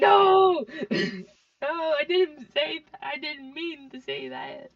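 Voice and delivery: high, loud flustered no, fading to a sheepish mutter; mock panicked denial. Telling friends how she panicked over a Spanish word. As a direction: blurt it out in mock alarm, then back down. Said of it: "And I'm like".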